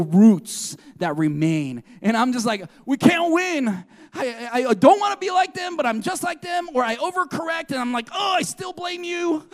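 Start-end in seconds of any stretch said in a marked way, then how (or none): 2.8-6.7 high-pitched, frustrated outburst, quicker in the middle; exasperated. Acted. Preacher voicing the struggle with parents' patterns, exasperated. 8.1-9.5 high-pitched mock complaint; exasperated cry, pitched up; frustrated blame. Preacher voicing an overcorrector blaming parents, frustrated.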